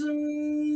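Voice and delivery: soft, sustained engine drone; plane engine hum. Acted. Pilot doing her figure eights, soft imitated engine hum. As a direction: hum the plane's motor softly and steadily. Miming flying her bank turns.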